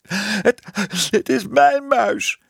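very high, halting tearful wail; sobbing child. A narrator's reading. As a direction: sob it out like an upset little girl. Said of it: "snikte ze". (hoog snikkend kinderstemmetje)

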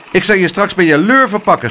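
loud, fast angry snap; snapping. A narrator's reading. The weatherman snapping a threat at the boy. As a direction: spit the threat out quickly. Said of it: "snauwde hij".

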